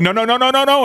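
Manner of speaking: high, frantic string of no's; mock horrified protest. Voicing the show's crew reacting to the dentures, high and frantic. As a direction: yelp it like you're stopping someone. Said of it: "They're like".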